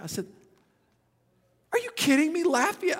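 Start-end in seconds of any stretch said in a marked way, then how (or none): 1.7-3.0 high, loud retold disbelief; incredulous. Young man hearing the mentor lives nearby, incredulous. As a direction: burst out in astonishment.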